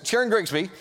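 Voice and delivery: a sudden fan's squeal of a name; gushing name drop. Shown as a demonstration. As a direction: blurt the name like a fan. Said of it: "did I say that out loud".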